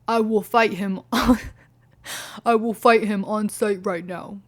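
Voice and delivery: a lightly voiced tough guy; mock macho guy. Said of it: "then he's like".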